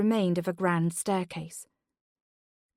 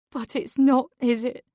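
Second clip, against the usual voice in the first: near tears; 5 semitones higher, 5 dB louder than usual. A narrator's reading. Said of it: "voice wavered on the edge of tears".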